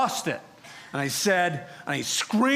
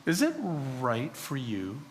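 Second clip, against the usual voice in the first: God's gentle question; 4 semitones lower, 6 dB quieter than usual. Retold or imitated. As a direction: ask it calmly, almost tenderly. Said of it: "I heard God say".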